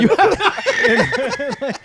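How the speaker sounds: high laughing turtle voice. Pretend. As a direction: squeeze it out like a turtle. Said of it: "this is my impression of a turtle".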